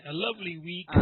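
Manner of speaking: Scottish phrase. Shown as a demonstration. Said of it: "a Scottish way of saying he's a nice guy".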